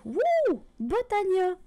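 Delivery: high, loud whoop of excitement; excited cheer. Real, not acted. Gamer keen to start on Botania, whooping excitedly.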